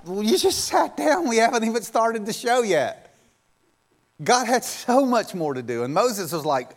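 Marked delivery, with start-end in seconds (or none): 0.1-3.1 high, incredulous protest; mock exasperation. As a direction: pitch it way up, as if astonished at their impatience. Mimicking God exasperated at Moses.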